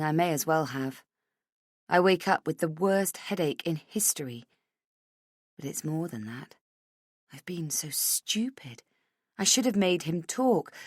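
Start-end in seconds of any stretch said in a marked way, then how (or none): 5.6-8.8 whispering. A narrator's reading. Inner self-reproach.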